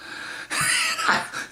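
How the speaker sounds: real high, breath-filled laugh; brief laugh bursting out high; incredulous laugh. Real, not acted. Man recalling his disbelief, real amused laugh.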